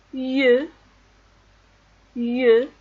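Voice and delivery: "Ye" loud, with rounded eu; répétition modèle. Shown as a demonstration. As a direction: call out "ye" for repeating. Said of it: "Alors on répète". (« ye » articulé fort, comme yeu)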